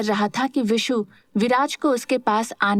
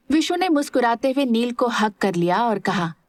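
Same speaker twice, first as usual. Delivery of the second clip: ordinary delivery, nothing marked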